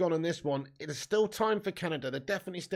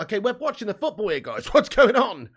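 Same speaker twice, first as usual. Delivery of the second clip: excitable put-on movie-star voice, higher and louder; Nicolas Cage; 4 semitones higher, 10 dB louder than usual. Streamer doing a requested impression over football, hammed-up excitement. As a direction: build to a loud, high outburst. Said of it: "Do Nicolas Cage".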